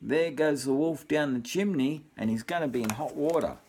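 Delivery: usual speaking voice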